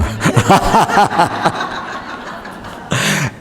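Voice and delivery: airy, high laugh with no voice; breathy laugh. Real, not acted. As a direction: laugh at the joke, under the breath. Laughing after retold joke.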